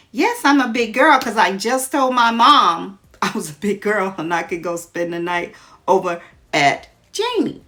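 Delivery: loud, eager insistence; insisting loudly. Acted. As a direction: prove you're a big girl, loudly.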